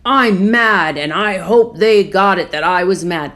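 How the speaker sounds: loud, emphatic venting; retold anger. Driving off angry from the pharmacy, mad. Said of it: "I left angry and I was like".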